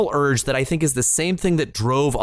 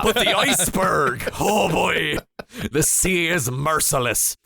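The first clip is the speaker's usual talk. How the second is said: sea captain voice; slower than usual. Retold or imitated. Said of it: "old salty sea captain".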